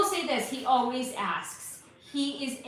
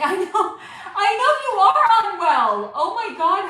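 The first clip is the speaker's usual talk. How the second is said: laughing through the words, louder and higher; 7 semitones higher, 10 dB louder than usual. Real, not acted. Mom laughing at a viewer's joking comment. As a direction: crack up while saying it.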